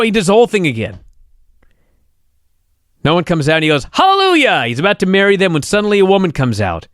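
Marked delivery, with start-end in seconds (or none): 3.9-4.7 mock hallelujah. Officiant exclaiming at wedding.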